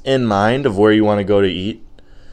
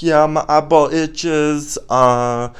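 put-on young boy; 3 semitones higher, 4 dB louder than usual. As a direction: play a kid reporting his ailment. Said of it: "And he's like".

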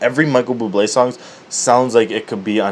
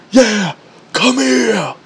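drawn-out high, loud holler; imitated yell; 12 semitones higher, 5 dB louder, much slower than usual. Mimicking lifter yelling to the weight, long yell. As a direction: stretch it into a slow mock bellow. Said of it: "He yelled to the weight".

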